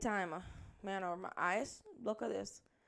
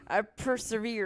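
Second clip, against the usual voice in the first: put-on Southern accent; 5 dB louder, slower than usual. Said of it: "Do I sound country when I speak like that".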